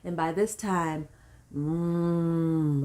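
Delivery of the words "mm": long droning "mmm" as in labor; labor moan. Shown as a demonstration. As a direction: demonstrate a loud, steady moan. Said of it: "I'm doing that".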